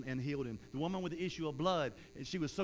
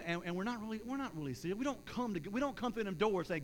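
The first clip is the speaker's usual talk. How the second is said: normal talking voice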